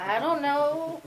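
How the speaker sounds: a sibling shrugging off the question; child's shrug. Retold or imitated. Slow sibling shrug, answering mom.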